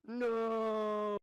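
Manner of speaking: high loud dying yell; anguished cry. Acted. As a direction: scream it as your head blows up. NPC's head explodes.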